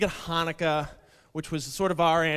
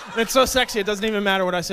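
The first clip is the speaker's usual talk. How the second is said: usual speaking voice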